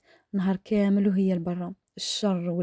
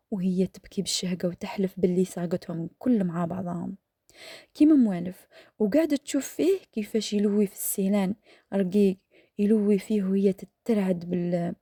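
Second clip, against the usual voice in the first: ordinary delivery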